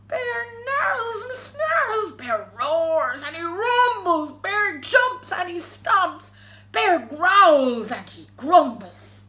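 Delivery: slow, booming storytelling; roaring bear narration. A narrator's reading. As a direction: roar the words out like the angry bear. Narrating the bear's roaring rampage.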